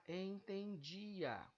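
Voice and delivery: entendia stretched slowly, en-ten-di-a; syllable by syllable. Shown as a demonstration. Teacher showing the only word with hiatus, entendia slowly syllabified. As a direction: draw out entendia, di and a as separate vowels. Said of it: "a única que apresenta hiato".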